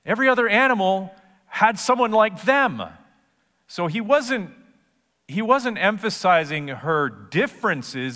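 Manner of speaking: high, loud preaching build; fervent sermon climax; preaching at full force. Acted. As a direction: drive the point home from the pulpit.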